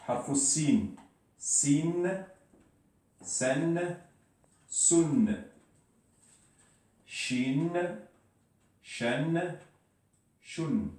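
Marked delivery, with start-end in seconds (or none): 1.4-10.9 syllables Sin, Sen, Sun set apart, each pitched high and held clear; careful demo. Shown as a demonstration. Teacher to Arabic learners shows the doubled vowels, pitched high and careful. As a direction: sound out Sin, Sen, Sun, Shin, Shen, Shun one by one for learners. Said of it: "Donc, la même chose".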